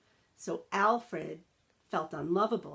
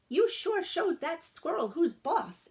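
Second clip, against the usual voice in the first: put-on eager young dog; 10 semitones higher than usual. A narrator's reading. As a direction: chirp it out like a friendly little pup. Rex praises Alfred.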